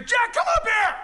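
high, loud imagined call from heaven; shouted summons. Pretend. Acts out the Lord's rapture shout.

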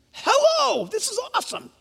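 high, hushed excitement; high-pitched giddy aside; playful awe. Acted. Preacher playfully hails the audience.

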